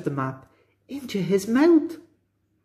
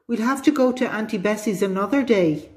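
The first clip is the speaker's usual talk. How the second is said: higher-pitched, lightly acted shout; mild storybook shout; 6 semitones higher than usual. A narrator's reading. Danny trying to put off the visit, mild shout. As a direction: read it as a boy's half-raised shout. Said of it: "he shouted".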